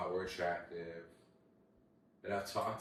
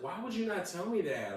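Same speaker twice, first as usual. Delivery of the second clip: outraged disbelief; 12 semitones higher, 3 dB louder than usual. Retold or imitated. Confronting a secretly married girl.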